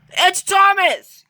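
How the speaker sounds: high, loud relieved call out; high, loud delighted call; excited call. Acted. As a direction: call out happily, slow and high. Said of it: "called his driver".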